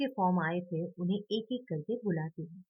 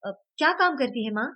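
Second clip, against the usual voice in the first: put-on impatient, higher and louder child; eager; 5 semitones higher, 8 dB louder than usual. A narrator's reading. Vedant eagerly pressing his friend about his mother's job. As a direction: press him eagerly for the answer. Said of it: "वेदांत उतावला होकर पूछता है".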